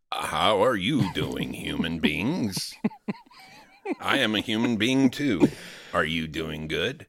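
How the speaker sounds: slow, stilted robot posing as human; mechanical, overly deliberate politeness; forced small talk. Acted. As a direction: say it word by word like an android. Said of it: "force myself to say".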